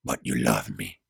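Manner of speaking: very low-pitched male character; pleading Gabriel. A narrator's reading. Gabriel reminds Mari of her love, deep pleading voice.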